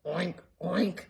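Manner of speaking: lightly voiced oink; oinking pig. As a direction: grunt it like a pig. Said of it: "all the librarian heard was".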